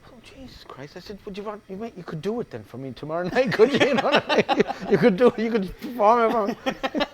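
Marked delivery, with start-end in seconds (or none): 1.2-7.1 higher, louder playful tease breaking into laughs; teasing through laughter. Pretend. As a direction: laugh while daring the fan to perform it. Teasing a repeat fan, laughing.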